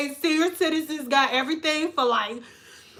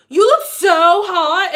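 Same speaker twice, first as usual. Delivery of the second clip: hammed-up gushing compliment, said louder; gushing older lady, louder; 2 semitones higher, 8 dB louder, slower than usual. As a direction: gush at her, lean on "look". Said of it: "They be like".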